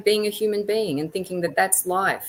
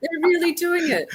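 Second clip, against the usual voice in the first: high, incredulous retold outcry; mock disbelief; 11 semitones higher than usual. Wrestling fan retelling the letdown to the host, in mock disbelief. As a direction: act out being stunned by the fake, voice pitched up. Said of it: "I couldn't believe it".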